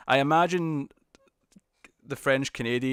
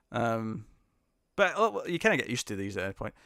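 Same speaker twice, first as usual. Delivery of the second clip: usual speaking voice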